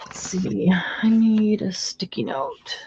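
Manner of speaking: whispered to herself; whispering. Real, not acted. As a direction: whisper it under your breath. Muttering to self while crafting.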